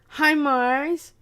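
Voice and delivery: usual speaking voice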